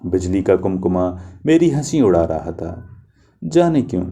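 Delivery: plain reading voice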